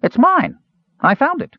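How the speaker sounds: put-on very high, loud, childish insistence; squeaky protest. Earwig defending his find from Caramon, squeaky protest. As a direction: squeal it like a kid guarding a toy. Kender squabbling over the ball.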